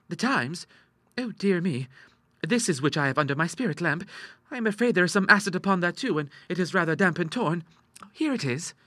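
put-on sheepish young lady, pitched high; apologetic daughter. A narrator's reading. Ida handing her father the spoiled paper, apologetic.

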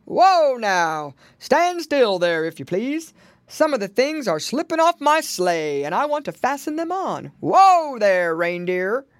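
big, loud Santa hailing his reindeer; calling out. A narrator's reading. Santa halting reindeer in the woods, loud calling. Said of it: "they heard a voice calling".

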